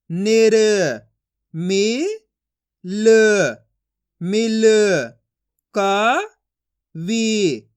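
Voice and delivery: slow, syllable by syllable, each sound separated; teacher sounding out letters for young pupils; phonics drill. Shown as a demonstration. Teacher reads Hindi syllables to class, slow and deliberate.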